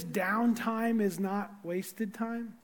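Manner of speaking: downtime is not wasted time, each word set apart, slow; slow emphatic statement. Real, not acted. Pastor telling the congregation a key truth, slow and deliberate. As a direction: lay it down slowly, word by word. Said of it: "Can I say this?".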